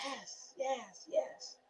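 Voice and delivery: breathless whisper; tearful whisper; whispered yes. Real, not acted. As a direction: barely voice it, overcome. Overcome, whispering agreement.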